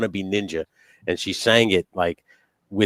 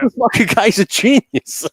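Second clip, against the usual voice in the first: put-on awed kid voice; 13 semitones higher, 7 dB louder than usual. Kid self awed by Bill Nye.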